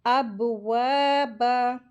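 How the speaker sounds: अबवाबा with bouncing b and long vowels; qalqala and madd. Shown as a demonstration. Teacher models qalqala, bounced b in slow अबवाबा. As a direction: bounce the first b of अबवाबा, stretch waa and baa. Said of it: "कलकला का rule apply होगा".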